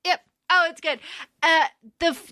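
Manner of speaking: ordinary talk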